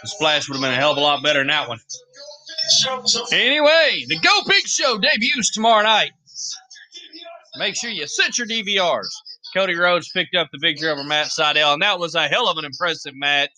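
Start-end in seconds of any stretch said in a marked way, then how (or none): 3.3-9.2 high, bright promo read; announcer voice. Acted. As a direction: sell it like a TV promo.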